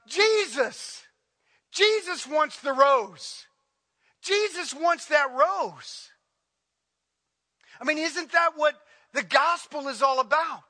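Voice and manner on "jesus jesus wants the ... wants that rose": high, slow called-out cry; shouting out. Retold or imitated. Matt wanting to shout back at the speaker, high slow cry. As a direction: cry it out like you're jumping up in protest. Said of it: "wanted to shout out".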